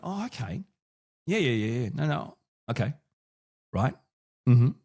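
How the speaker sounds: mildly feigned interest in short replies; half-hearted show of keen listening; fake enthusiasm. Shown as a demonstration. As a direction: play along with polite listening noises. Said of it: "I add fake enthusiasm".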